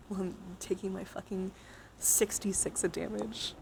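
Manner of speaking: normal talking voice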